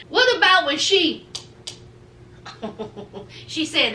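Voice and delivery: usual speaking voice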